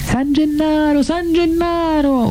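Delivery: very high, drawn-out slow wail; stretched, high cries that fall away at the end; crowd shouting. A narrator's reading. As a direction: howl the saint's name like a despairing crowd. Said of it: "urlava la gente".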